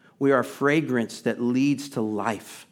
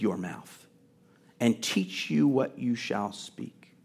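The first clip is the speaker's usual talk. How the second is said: solemn scripture reading; much slower than usual. A narrator's reading. Reads God's words from scripture.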